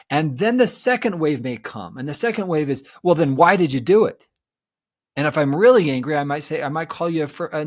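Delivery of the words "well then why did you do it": louder, pressing demand; demanding question. Pretend. Betrayed partner demanding why in the second wave, louder. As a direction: push for an answer.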